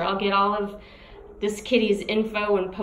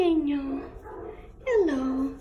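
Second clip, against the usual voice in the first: ordinary talk, nothing marked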